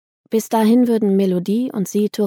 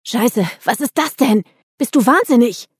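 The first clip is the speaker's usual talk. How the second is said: higher, louder, alarmed outburst; shouting; 5 semitones higher, 5 dB louder than usual. A narrator's reading. Assia sees the weapon in the car, shouting. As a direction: cry out in shocked disbelief. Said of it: "schrie Assia".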